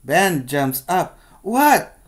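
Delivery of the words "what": loud, high What; startled yell. Acted. Ben startled out of daydreaming, yelling. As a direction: jolt awake and yell it. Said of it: "he yells".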